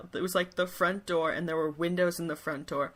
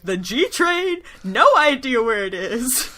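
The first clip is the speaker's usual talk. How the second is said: loud, high mock exasperation; exasperated complaint; 6 semitones higher, 9 dB louder than usual. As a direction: gripe loudly as the stranded kings. Retold kings griping about subway.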